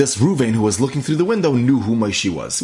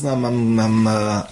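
higher, hesitant stammer of someone caught unawares; surprised stammer; 3 semitones higher, slower than usual. Retold or imitated. Man found crying in shul by his friend, flustered stammer. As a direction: caught off guard, drawn-out stumbling over the first words. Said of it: "like, very surprised".

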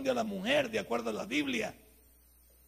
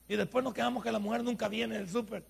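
ordinary talk, nothing marked

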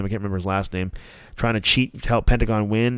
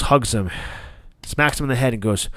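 usual speaking voice

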